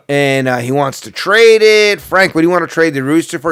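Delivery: hammed-up local radio host; radio announcer. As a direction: announce it big, drawing out the key words. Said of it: "the announcer would be like".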